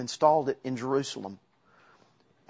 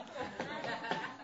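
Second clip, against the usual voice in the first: light laugh; 9 semitones higher, 13 dB quieter than usual. Real, not acted. Laugh after quote.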